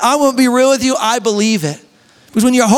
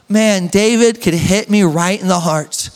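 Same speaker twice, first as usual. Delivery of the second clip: normal talking voice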